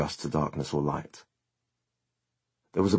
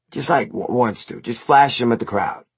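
higher, louder coaxing dude; eager bandmate Eric; 6 semitones higher, 3 dB louder than usual. A narrator's reading. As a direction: urge it like an excitable bandmate. Plays Eric in the story.

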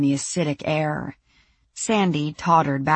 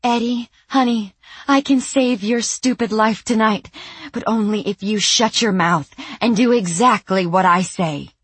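fierce pleading; 6 semitones higher, 4 dB louder than usual. Acted. Sandy pleading then threatening.

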